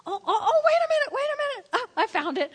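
high, loud, laughing oh-oh-oh of discovery; laughing surprise. Real, not acted. Preacher finds his missing item, laughing in surprise. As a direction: pipe up delighted at finding it.

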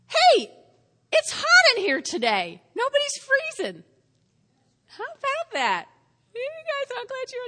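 high, loud teasing call to the room. Real, not acted. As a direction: call out playfully over everyone. Calls out to congregation.

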